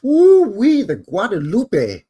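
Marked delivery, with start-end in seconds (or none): 0.0-0.8 woo-wee. Real, not acted. Excited exclaim about the river.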